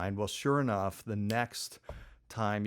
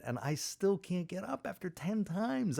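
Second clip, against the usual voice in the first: higher, slow, drawn-out complaint; frustrated disbelief; 9 semitones higher, 3 dB quieter than usual. Retold or imitated. Skier who still can't get up, exasperated. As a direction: stretch it out high in exasperation. Frustrated learner recalling failures.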